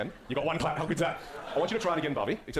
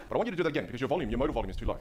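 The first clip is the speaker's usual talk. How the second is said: ordinary delivery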